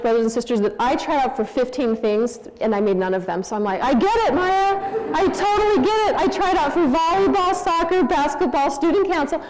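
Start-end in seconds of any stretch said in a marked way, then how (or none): 3.8-9.4 high, animated insistence; emphatic empathy. Retold or imitated. Mother telling daughter of her own tryouts, emphatic empathy. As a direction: pile on the fellow feeling, voice pitched up.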